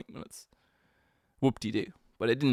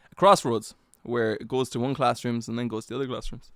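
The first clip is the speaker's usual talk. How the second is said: ordinary talk, nothing marked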